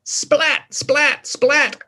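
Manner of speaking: high playful splat splat splat; splat sound effect. A narrator's reading. Reading to children as eggs drop, playful high sound effects. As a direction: voice the eggs landing for the kids.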